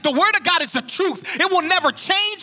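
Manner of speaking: high, loud, rising sermon cadence; fiery pulpit preaching; preaching at full force. Acted. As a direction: drive each line home to the congregation.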